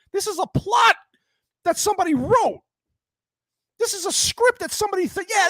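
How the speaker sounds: high-pitched, incredulous outrage; exasperated rant. Real, not acted. Reviewer tearing into a film's absurd plot twist, exasperated. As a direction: rant in disbelief, pitch pushed way up.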